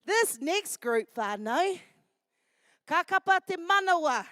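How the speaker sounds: slow, loud stage announcement; announcer introduction. Acted. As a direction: announce the group to the crowd. MC introduces the next group.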